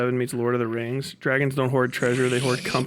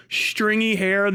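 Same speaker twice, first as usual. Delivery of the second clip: ordinary talk, nothing marked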